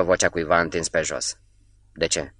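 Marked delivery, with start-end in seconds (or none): 1.9-2.3 low, soft question that is also an order; questioning command. A narrator's reading. Wounded man questions Catov, a quiet commanding question. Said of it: "Întrebare și ordin totodată".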